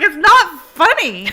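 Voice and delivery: stern scolding. Retold or imitated. Mary Beth scolding her laughing friend, stern and loud. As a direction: scold her that it's not funny. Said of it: "Mary Beth's like".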